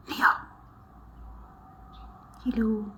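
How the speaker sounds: hushed hello; soft whisper. Real, not acted. Vlogger greets viewers again quietly, soft whisper.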